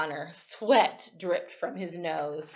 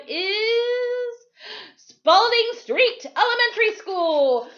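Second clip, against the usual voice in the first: big, loud TV host announcing; game show announcer; 8 semitones higher, 6 dB louder than usual. Acted. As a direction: build excitement up to the winner's name. Quiz host announces the winner.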